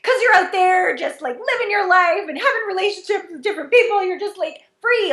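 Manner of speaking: high, rising complaint, building to a peak; high-pitched mock envy; whiny envy. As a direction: pout it out like a jealous kid. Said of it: "I go".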